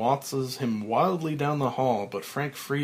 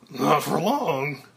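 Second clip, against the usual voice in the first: smiling threat; 3 semitones higher, 5 dB louder, slower than usual. Acted.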